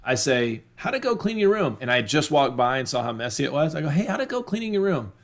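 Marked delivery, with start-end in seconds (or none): 0.8-1.8 much higher, friendly and upbeat; a cheerful dad checking on the room; encouraging question. Shown as a demonstration. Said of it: "You hear the difference?". 3.9-5.1 higher, friendly check-in; breezy, supportive dad question; a dad walking past a messy room; encouraging. Shown as a demonstration. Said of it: "I'm enforcing, "I believe in you".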